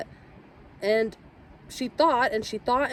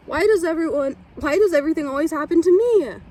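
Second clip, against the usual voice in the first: put-on higher and louder, a child's dramatic lament; sulking girl in the chair, loud and higher; self-pitying wail; 6 semitones higher, 5 dB louder than usual.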